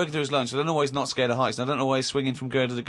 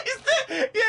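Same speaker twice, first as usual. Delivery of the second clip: laughing. Real, not acted. Laughing at the union angle.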